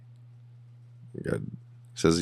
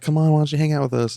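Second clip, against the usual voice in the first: mock coaxing wife; 9 semitones higher, 3 dB louder, faster than usual. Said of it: "She's like".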